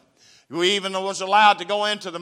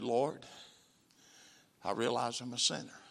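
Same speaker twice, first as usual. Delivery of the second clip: soft repeated prayer; 5 semitones lower, 10 dB quieter than usual. Retold or imitated. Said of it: "a voice said".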